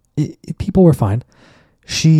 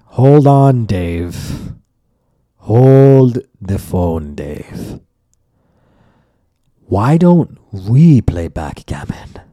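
slow, deliberate mock-calm challenge; cool challenge; 4 dB louder, much slower than usual. Grandma cutting off the neighbor, slow and deliberate. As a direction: draw out each word to stop him. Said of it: "my grandma's like".

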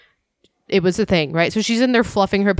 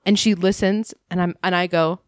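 ordinary talk

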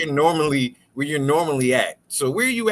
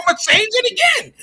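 shouting; faster than usual. Real, not acted. Shouting back over cohost.

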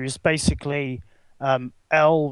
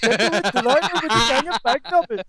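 put-on Swedish accent; 7 semitones higher than usual. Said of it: "totally doing this in a Swedish accent".

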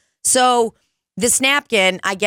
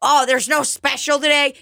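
higher-pitched pestering customer; put-on customer voice, pitched up; mock customer complaint; 8 semitones higher than usual. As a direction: imitate a customer whining about the specials.

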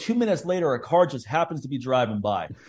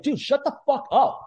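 high, exasperated mock snap; hammed-up irritated telling-off; fed-up passenger telling off a panicking man; retold snap; 11 semitones higher than usual. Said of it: "I was like".

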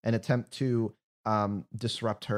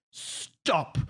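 priest command; 7 semitones higher than usual. Acted. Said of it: "priest casts Hold Person on them and says".

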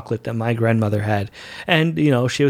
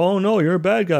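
higher, mocking jeer of "bad guy"; mock accusing officers; 6 semitones higher than usual. Officers accusing Kirk of murder, mock jeering. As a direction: tease the accused like a cartoon crowd. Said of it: "And people are like".

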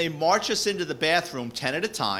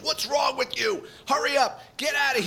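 retold angry yelling; 11 semitones higher than usual. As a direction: berate him like a fed-up sergeant, pitched up high. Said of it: "And he goes ballistic".

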